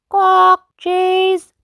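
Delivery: very high squawking bird; Polly Parrot. A narrator's reading. Parrot copying the order, very high squawk voice. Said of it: "Polly Parrot copied".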